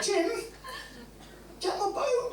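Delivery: high, slow, laughing call; squealing with laughter as the chair is taken; laughing excitement. Retold or imitated. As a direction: cry out to Jim through laughter. Calling out to mate Jim.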